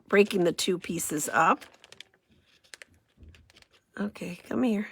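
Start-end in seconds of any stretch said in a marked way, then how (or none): 4.0-4.9 whispered coaxing. Real, not acted. Coaxing the paper into place, whispered.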